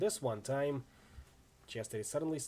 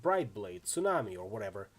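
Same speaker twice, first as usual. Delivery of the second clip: ordinary delivery, nothing marked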